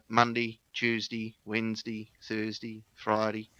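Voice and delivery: "Monday, Tuesday, Wednesday, Thursday, Friday" each ending in "dee"; shortened Aussie day names. Shown as a demonstration. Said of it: "We're just gonna shorten it".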